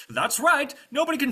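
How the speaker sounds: high, loud self-satisfied knight; boastful knight. Acted. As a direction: brag until cut short.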